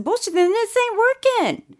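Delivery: high-pitched indignant retold outburst; dramatic outburst. Regina storming at the car salesmen, indignant outburst. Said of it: "you was being extra dramatic".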